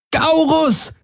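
high, piercing scream of a name; a desperate high cry across the room. A narrator's reading. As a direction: shout for him in panic. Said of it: "schrie Kirai".

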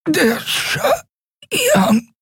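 high, strained choking voice; strangled. A narrator's reading. Captive answering while being choked, strangled. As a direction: squeeze the words out through a tight throat.

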